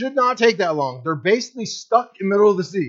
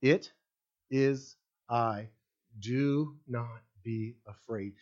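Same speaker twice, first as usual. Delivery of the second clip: slow, quieter solemn words of Jesus; solemn quote; 7 semitones lower, 9 dB quieter, much slower than usual. Retold or imitated. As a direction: lay each word down slowly and gravely. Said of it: "exact words is what Jesus said".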